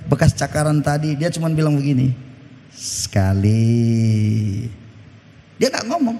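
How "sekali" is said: much lower, very slow single word; a deep, drawn-out "Sekali" from a wife keeping score; wife's ominous count. Retold or imitated. Wife's quiet count to husband.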